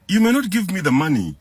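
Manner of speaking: ordinary delivery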